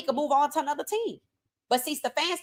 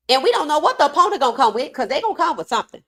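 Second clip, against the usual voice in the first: worked up; 4 semitones higher, 5 dB louder, faster than usual. Real, not acted. Worked-up rant about opponents.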